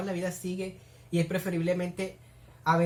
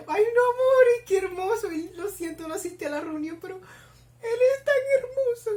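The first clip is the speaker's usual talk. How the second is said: high, squeaky swooning; melting fangirl whine, far above usual pitch; gushing adoration; 12 semitones higher, 4 dB louder than usual. Real, not acted. Gushing over the film character.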